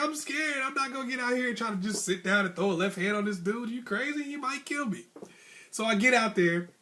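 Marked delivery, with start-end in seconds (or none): 0.0-5.0 high-pitched scared inner voice; comic panic. Retold or imitated. Scared boxer refusing coach's plan in his head, high mock panic.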